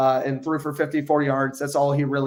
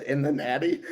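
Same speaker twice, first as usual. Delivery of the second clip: incredulous echo; 3 dB quieter than usual. Retold or imitated. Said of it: "He said, he said".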